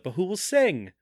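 imitated plea. Diana asks Charlie who will sing, imitated plea. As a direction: lift the voice, imitating her question.

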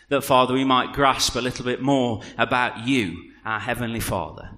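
soft, even congregational prayer. Real, not acted. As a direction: lead them gently in prayer.

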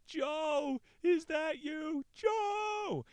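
very high, loud calling shout; a friend shouting for the missing climber; strained shout. Acted. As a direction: call his name across the dark, voice straining. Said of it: "Simon's voice sound cracked with strain".

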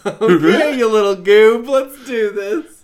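loud, laughing and amused. Real, not acted. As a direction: laugh it out, fond teasing. Laughing, excited reply.

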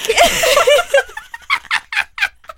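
shrieking fit of laughter; high helpless laugh. Real, not acted. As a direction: lose it completely, laughing high. Cracking up after the headline.

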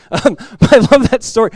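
ordinary talk

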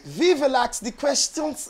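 exaggerated German accent, pitched high; mock German accent. Shown as a demonstration. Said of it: "It sounded a little Nazi-ish".

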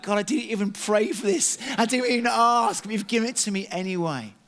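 high, loud astonished gratitude; delighted surprise at the free table; amazed thanks. Retold or imitated. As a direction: exclaim in happy disbelief. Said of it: "I was just like".

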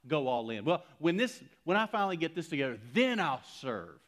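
loud, hammed-up voice of a putting-it-off believer; mock excuse-maker. Preacher mocking believers who keep delaying God, loud and hammed-up. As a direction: mimic the excuses, high and loud.

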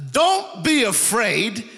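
lightly voiced happy Jesus; mild exuberant comfort. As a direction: cheer them up from afar. Jesus voice reassuring, preacher acting.

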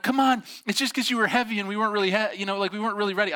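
high, quick protesting voice; excuse-making sisters. Retold or imitated. Sisters explaining the second fall to their brother, excuse-making. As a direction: rattle off excuses as a pleading sister.

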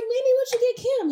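high, put-on voice for her own question; a friend retelling how she asked Manny, in a high voice; mock asking. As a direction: replay the question in a high pitch. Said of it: "And I was like".

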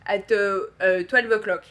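hesitant French-accented English; halting French receptionist accent. Shown as a demonstration. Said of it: "my French accent, I hesitate".

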